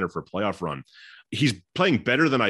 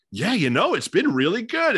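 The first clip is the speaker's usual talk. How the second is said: much higher-pitched, surprised imitation; 12 semitones higher, 4 dB louder than usual. Monotone player asked about the season, audibly surprised. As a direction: play up the pleasant surprise in a quote. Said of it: "almost sense of surprise in his voice".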